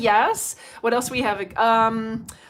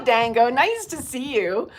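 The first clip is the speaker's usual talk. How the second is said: ordinary talk, nothing marked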